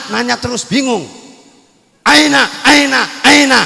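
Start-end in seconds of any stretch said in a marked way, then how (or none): none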